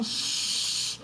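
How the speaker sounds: breathless hushing shh; imitated shush. Retelling a recording, imitating the man's hush. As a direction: shush like a man hushing a child. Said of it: "as he's going".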